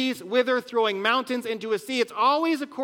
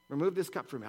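hushed anguished plea; 7 semitones lower, 10 dB quieter, faster than usual. Acted. Jesus praying in agony, Gethsemane.

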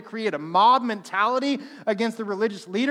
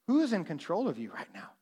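soft, low confronting tone; quiet firm question; 3 semitones lower, 8 dB quieter than usual. Retold or imitated. Preacher quietly confronting the disruptive man outside. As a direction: ask it quietly and pointedly.

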